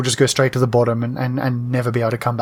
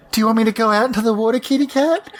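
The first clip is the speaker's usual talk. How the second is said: put-on fast, higher baby-talk to a cat; talking to a pet; 7 semitones higher, faster than usual. Player imitating Athora asking her cat, mock pet-talk. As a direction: rattle it off like cooing at a kitty. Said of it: "She's like".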